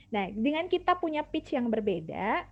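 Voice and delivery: ordinary talk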